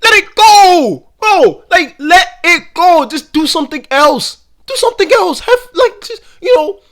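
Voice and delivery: high, loud, pleading shout; exasperated yelling. Real, not acted. Begging podcasters to drop the catchphrase, exasperated shouting. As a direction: yell it out, completely fed up. Fed-up outburst after played clip.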